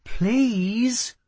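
pleading. A narrator's reading. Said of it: "she said again".

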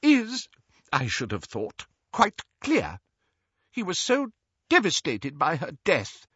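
high, halting, emotional defence; upset but formal, with pauses; stiff with emotion. A narrator's reading. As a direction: hold back feeling, stiffly. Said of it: "in a voice stiff with emotion".

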